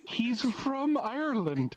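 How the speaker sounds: slow, high emphasis on "Ireland"; exasperated emphasis. Real, not acted. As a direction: spell out "Ireland" slowly, as if it were obvious. Exasperated at viewers doubting a real Irishman.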